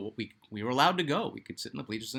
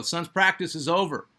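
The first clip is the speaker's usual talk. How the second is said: louder, higher impatient complaint; imitated yelling; 6 semitones higher, 6 dB louder than usual. Brother yelling at the Suns trainer to get off the court, imitated yell. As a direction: act out a kid yelling at the trainer. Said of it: "My brother would yell".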